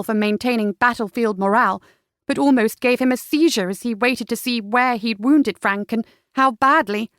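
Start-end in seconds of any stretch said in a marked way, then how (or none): none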